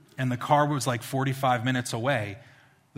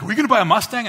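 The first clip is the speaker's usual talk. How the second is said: put-on higher and louder, an eager boy's question; excited kid; 10 semitones higher, 12 dB louder, much faster than usual. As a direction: sound thrilled at the idea of a Mustang. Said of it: "And he's like".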